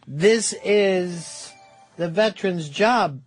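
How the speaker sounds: usual speaking voice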